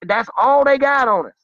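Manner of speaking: high, loud cry of conviction; preacher's rising shout; impassioned shout. Real, not acted. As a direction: shout it out to the crowd. Repeated refrain, climax.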